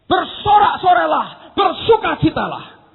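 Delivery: high, loud exultant command; rousing cry. Acted. Preacher quotes David commanding his downcast soul, rousing cry. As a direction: draw out each rallying word.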